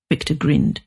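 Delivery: ordinary delivery, nothing marked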